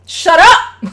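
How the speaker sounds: loud, high shout; furious shout. Retold or imitated. Father shouting at the wife mid-argument, furious shout. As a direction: bark it at her to shut up. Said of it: "would turn to the wife and was like".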